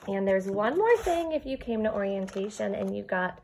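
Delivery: ordinary delivery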